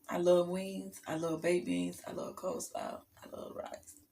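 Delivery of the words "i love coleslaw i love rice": whispering. Real, not acted. Listing foods she loves, whispered.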